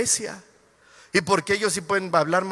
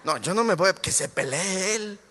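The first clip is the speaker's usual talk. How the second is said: much softer, lower mock backing-off; mumbled mock excuse of a shy churchgoer; timid churchgoer; 6 semitones lower, 4 dB quieter than usual. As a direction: play a member shrinking from a fight. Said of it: "Ya lo veo como que".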